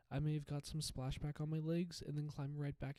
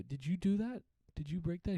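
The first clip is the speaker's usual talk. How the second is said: higher and louder, demanding; loud accusing question; 4 semitones higher, 8 dB louder than usual. Retold or imitated. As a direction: call him out across the room. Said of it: "and I go".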